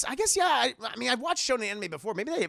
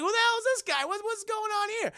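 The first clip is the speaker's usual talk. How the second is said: much higher startled outcry; mock shock. Heroes seeing the villain in the arena, high mock alarm. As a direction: squeal it in cartoon alarm. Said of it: "Yusuke and Kuwabara both are like".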